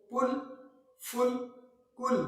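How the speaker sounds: "पुल, फुल, कुल" said crisply one by one; word list demo. Shown as a demonstration. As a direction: list the practice words. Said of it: "मुख्तलिफ लफ्जों के साथ सीखना चाहें". ("पुल, फुल, कुल" एक एक करके साफ़)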